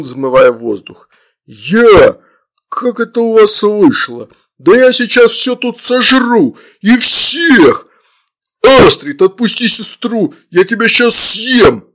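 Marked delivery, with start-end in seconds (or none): 1.5-11.9 high, loud ranting threats; enraged demon. A narrator's reading. Demon threatens to devour children, enraged shouting. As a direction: rant at full voice, pitch climbing.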